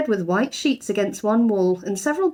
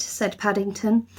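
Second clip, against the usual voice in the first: ordinary delivery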